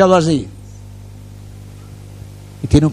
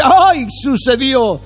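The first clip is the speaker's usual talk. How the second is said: slow, high, drawn-out mock amazement; surprised gasp; 8 semitones higher, 3 dB louder, much slower than usual. A believer seeing the miracle arrive, slow and mock-amazed. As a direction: stretch it out like a happy shock. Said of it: "uno dice".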